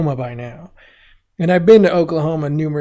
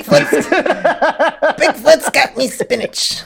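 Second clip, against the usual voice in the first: hammed-up sailor cartoon voice; bad Popeye voice; 10 semitones higher, 4 dB louder than usual. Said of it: "bad Popeye impression".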